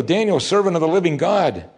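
high, troubled calling out; troubled cry. A narrator's reading. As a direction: cry out anxiously to someone below. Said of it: "cried out with a troubled voice".

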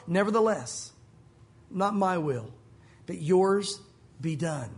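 low, slow, resigned yielding to God; surrendered prayer. Acted. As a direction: let go and yield, slowly. Surrender after passionate prayer.

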